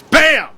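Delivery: loud, high "Bam!" for a hit; imitated punch. Host mimics the cheater's punch with a loud bam. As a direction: smack it out like a punch landing.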